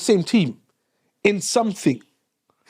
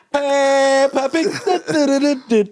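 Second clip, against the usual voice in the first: drawn-out Peppa Pig; 5 semitones higher, much slower than usual. Pretend. Mock announcing kids' show.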